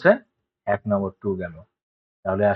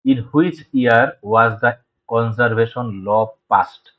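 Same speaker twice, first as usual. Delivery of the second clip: slow read-aloud; 4 dB louder, much slower than usual. Shown as a demonstration. Teacher reads example question.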